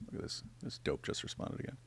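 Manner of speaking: hushed whisper; quietly whispered aside. Real, not acted. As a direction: whisper it as if to yourself. Aside while reading new reply.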